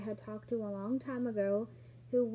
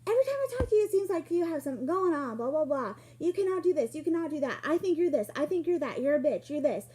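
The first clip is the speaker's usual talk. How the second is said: loud, high, furious tirade that starts at a peak and stays loud; angry rant; 5 semitones higher, 7 dB louder, faster than usual. Retold or imitated. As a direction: hammer each accusation as if going ballistic on someone. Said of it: "Goes absolutely ballistic on me".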